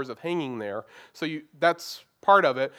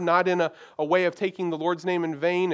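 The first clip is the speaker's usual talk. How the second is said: ordinary talk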